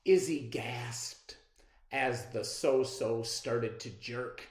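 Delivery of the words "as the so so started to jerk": ordinary delivery, nothing marked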